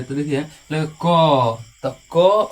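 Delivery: क said slowly, then punched loud; loud demo. Shown as a demonstration. Teacher to students, a loud demo of क. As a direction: hit क hard so the अ rings out. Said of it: "आप बोल के देखिएगा".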